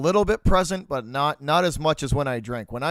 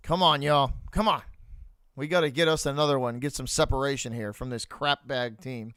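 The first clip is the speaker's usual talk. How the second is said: ordinary talk, nothing marked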